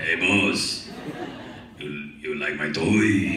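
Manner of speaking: slow, booming bass giant; very low and loud, dragging the words; Andre. Retold or imitated. Giant calling the actor over to his toy, deep and slow. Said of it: "Yes, Andre".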